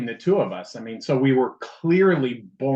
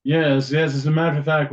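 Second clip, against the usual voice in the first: hammed-up posh-ish accent, louder; funny accent; 5 dB louder than usual. Cohost answering the host in a louder mock accent. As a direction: agree in a mock grand accent. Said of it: "talking in funny accents".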